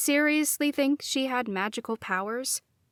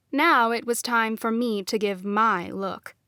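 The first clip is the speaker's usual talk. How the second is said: plain reading voice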